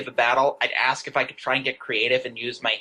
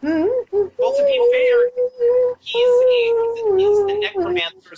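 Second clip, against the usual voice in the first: 1 high laughing voice; 6 dB louder, much slower than usual. Real, not acted. Laughing after dance joke.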